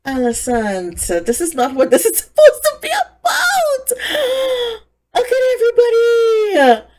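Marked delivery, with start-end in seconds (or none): 1.3-6.8 outraged shout. Real, not acted. Viewer yells at Alicent on screen, outraged and high. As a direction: shriek at her in disbelief.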